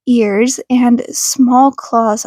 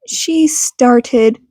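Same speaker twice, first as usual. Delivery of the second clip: ordinary delivery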